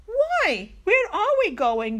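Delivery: high, loud, acted childlike questioning; puzzled children asking. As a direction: ask like baffled kids, pitched right up. Children question sudden early outing.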